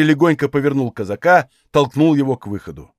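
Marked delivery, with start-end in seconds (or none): none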